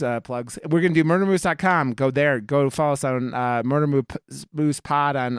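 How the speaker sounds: ordinary talk, nothing marked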